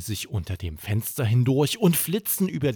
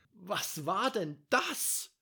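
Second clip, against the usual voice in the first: Mama von fern; 10 semitones higher, 9 dB quieter, slower than usual. Acted. Mama hinter der Wand.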